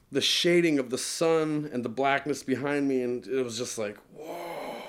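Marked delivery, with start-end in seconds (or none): 4.1-4.7 soft, breathed-out whoa of wonder; hushed awe. Retold or imitated. Man recalling the glowing sun, hushed awe. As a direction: marvel under your breath.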